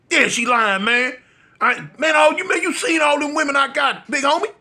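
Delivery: retold shout. Friend bragging about his women to the narrator in a mock yell.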